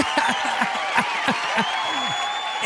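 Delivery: long, high cackle mimicking someone; drawn-out mock cackle; imitated cackle. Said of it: "The lady was cackling".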